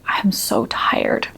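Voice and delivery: slow, breathy whisper on the edge of tears; tearful whisper. Retold or imitated. Admitting exhaustion to a roommate in a tearful whisper. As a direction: let it out in a weak whisper, almost crying. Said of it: "I could feel the tears in my eyes".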